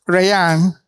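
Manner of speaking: ordinary delivery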